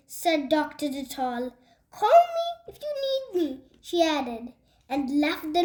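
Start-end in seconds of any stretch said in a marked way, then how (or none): 1.9-3.6 quick, high-pitched doctor's voice; parting call. A narrator's reading. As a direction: toss it off briskly on the way out. Doctor calls out while leaving room.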